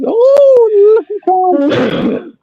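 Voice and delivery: very high, slow, loud leprechaun squeak. Pretend. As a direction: stretch it out like a tiny leprechaun. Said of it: "I gotta say it like a leprechaun".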